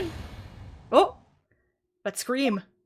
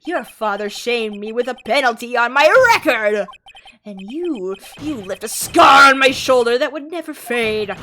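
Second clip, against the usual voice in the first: furious villain rant; 8 dB louder than usual. Acted. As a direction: roar it with murderous rage. Villain rages at Edgeworth.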